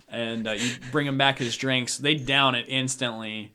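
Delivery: ordinary talk, nothing marked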